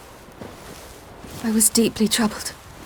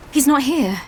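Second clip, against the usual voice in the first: normal talking voice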